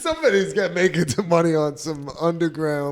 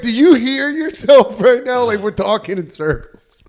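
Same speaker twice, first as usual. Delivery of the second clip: mock exasperated rant; 8 semitones higher, 8 dB louder than usual. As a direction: replay the frustration at the agent, half laughing. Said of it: "I'm like".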